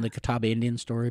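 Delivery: ordinary talk, nothing marked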